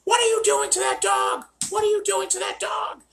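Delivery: shrill, very high shouted mock lady; high falsetto screech at the trainer. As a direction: screech it like an outraged busybody. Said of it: "she's like".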